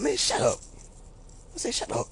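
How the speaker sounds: soft, offhand dismissal; not mad. Retold or imitated. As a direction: brush them off without anger. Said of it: "I don't be mad".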